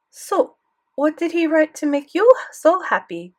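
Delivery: put-on high, loud French-accented question; prodding grandmother with a French accent. A narrator's reading. Grandmother asking what the teacher wrote, French accent. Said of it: "Grandmere had a thick French accent".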